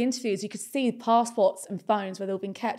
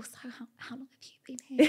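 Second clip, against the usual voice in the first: whisper; 2 semitones higher, 6 dB quieter, faster than usual. Retold or imitated. Whispering in silent waiting room.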